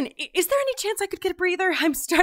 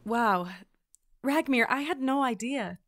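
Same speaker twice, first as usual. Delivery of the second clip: lower, softer condolence; sympathetic; 4 semitones lower, 5 dB quieter than usual. Acted. Recruit consoling the grieving trainer, soft and sincere.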